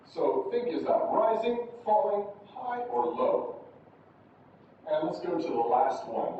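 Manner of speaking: usual speaking voice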